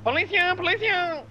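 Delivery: high-pitched alarm call; urgent calling out. Pretend. Warning the crew of police, urgent high call. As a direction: cry out a repeated warning.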